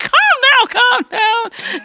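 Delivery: high mock mom voice; soothing mom. Mother calming her daughter, in a high mock soothing voice. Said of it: "her mom was like".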